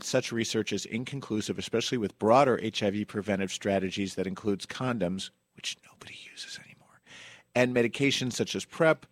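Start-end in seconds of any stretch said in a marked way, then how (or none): 5.6-7.0 a sly whispered aside. Real, not acted. Host whispers a snarky aside about condoms. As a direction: drop to a breathy whisper for the joke.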